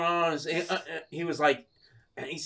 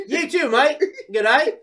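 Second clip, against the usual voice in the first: high, loud mock Aussie farewell; Australian accent; 12 semitones higher, 7 dB louder than usual. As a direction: sign off as a fake Australian mate. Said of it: "She thinks I'm from Australia".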